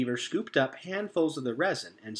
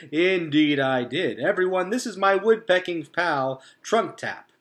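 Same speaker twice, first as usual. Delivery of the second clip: hearty beaver; 5 semitones higher, 6 dB louder than usual. A narrator's reading. Mister Beaver introducing Trunk Tap, loud and hearty. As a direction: introduce your pal with gusto.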